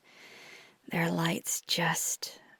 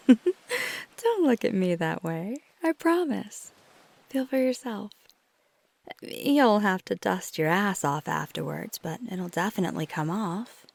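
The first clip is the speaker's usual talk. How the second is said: louder, voiced and teasing; playful teasing; 2 semitones higher, 6 dB louder than usual. Teasing the partner about sitting in sand, voiced. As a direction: break the whisper with a playful tease.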